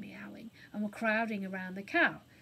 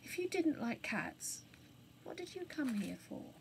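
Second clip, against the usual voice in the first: gentle, quiet question; kind and soft; 4 semitones higher, 6 dB quieter than usual. Acted. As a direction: ask softly, kindly. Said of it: "kindly and softly".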